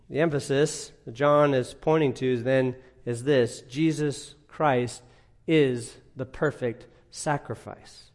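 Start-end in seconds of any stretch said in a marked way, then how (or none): none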